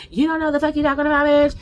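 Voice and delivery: loud, fast, high angry snap at a rival; furious retold outburst. Cast member snapping at Jessie on the show, furious outburst. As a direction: spit it back like she's losing it. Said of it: "she got so mad".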